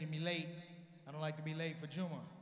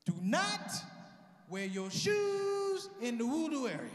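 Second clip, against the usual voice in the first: higher, loud, slow bellowed command; sudden shout; 5 semitones higher, 8 dB louder, slower than usual. Retold or imitated. Guard shouting the rule at the comic, loud and slow. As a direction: explode into a stern bark.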